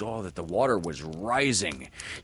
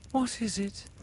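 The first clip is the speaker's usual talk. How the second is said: put-on high, worried girl voice; girl anxious about the boy. A narrator's reading. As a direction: ask with sudden worry. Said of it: "anxious about him".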